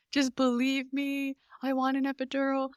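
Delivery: crying plea. Retold or imitated. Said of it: "crying to my husband".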